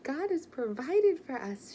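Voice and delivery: crying out the good news; joyful cry. A narrator's reading. Mother tells George the money came, loud and joyful. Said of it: "she cried".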